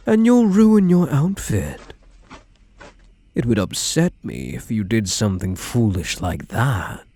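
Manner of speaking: ordinary delivery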